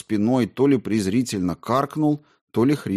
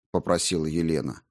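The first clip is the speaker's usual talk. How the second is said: ordinary delivery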